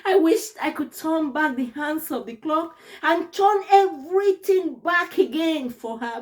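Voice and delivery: usual speaking voice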